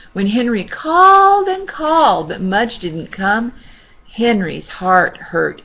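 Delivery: ordinary delivery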